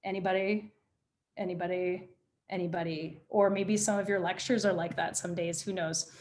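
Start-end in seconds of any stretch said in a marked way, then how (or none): 0.0-3.2 monotone teacher roll call. Retold or imitated. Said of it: "if you ever saw Ferris Bueller's Day Off".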